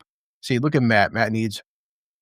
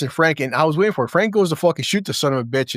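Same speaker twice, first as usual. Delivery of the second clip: ordinary talk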